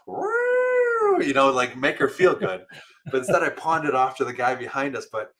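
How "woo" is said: very high, long "woo"; sustained high mock whoop; playful siren-like woo. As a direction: imitate running off with a high wail. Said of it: "run away just going".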